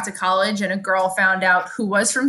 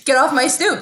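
loud, higher-pitched stoop holler; hammed-up neighborhood shout; mock Brooklyn local; 6 semitones higher, 6 dB louder than usual. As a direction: playfully yell like a territorial Brooklynite. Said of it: "That's usually what we say".

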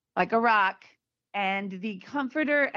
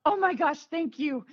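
higher, relieved outburst of thanks; 8 semitones higher than usual. Retold or imitated. Husband thanking the little girl, higher and relieved. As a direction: gush thanks like a weight just lifted. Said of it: "And he was so relieved".

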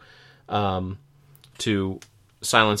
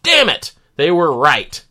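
exasperated outburst; 6 semitones higher, 8 dB louder, slower than usual. Pretend. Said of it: "and I'll be like".

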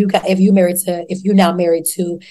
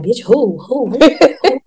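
mock shock; 5 semitones higher, much slower than usual. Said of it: "Everybody looked like".

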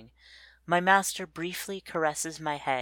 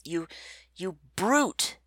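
sharp, higher angry yell; yelling insult; 4 semitones higher than usual. A narrator's reading. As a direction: hurl the insult at the master. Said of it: "they yell at my master".

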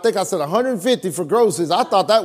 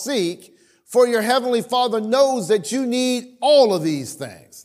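scripture reading; 5 semitones higher than usual. Acted. Reads scripture aloud.

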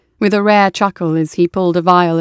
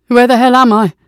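loud, rising alarmed yell; confused soldier shouting in fear; panicked shout; 4 semitones higher, 7 dB louder than usual. Acted. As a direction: demand to know where you are.

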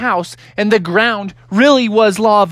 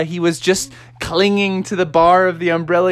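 ordinary delivery

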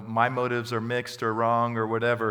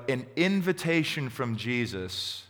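normal talking voice

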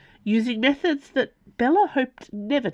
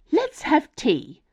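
high, slow, inviting teapot voice; mammoth teapot; 9 semitones higher, slower than usual. A narrator's reading. Mammoth teapot suggesting tea to the room, inviting. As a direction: draw out a high-pitched invitation. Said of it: "suggested the mammoth teapot".

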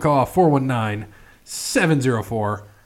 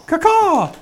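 high, strained parrot squawk; chirping bird person; 6 dB louder than usual. Acted. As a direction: caw it with a bird timbre. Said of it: "you gotta give him his call".

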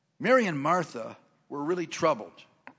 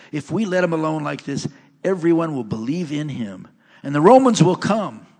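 loud, measured Bible reading from the pulpit; scripture reading; 11 dB louder than usual. Acted. Pastor reads John's gospel to the congregation, loud proclaiming read.

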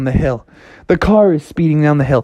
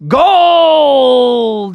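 loud bear yell; 9 semitones higher, 5 dB louder than usual. Acted. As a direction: bellow it hungrily.

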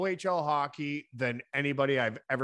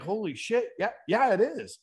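retold amazement; 8 semitones higher than usual. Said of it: "And I'm like".

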